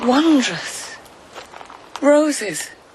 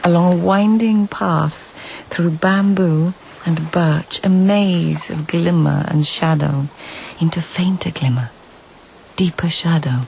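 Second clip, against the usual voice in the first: louder, measured storytelling read; story narration; 6 dB louder than usual. A narrator's reading. Narrator recounting the search through forest, storytelling voice. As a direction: paint the journey step by step.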